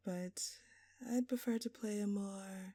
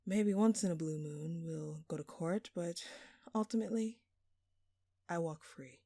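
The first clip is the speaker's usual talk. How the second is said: usual speaking voice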